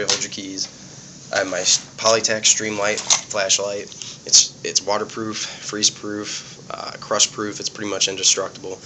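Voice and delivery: ordinary delivery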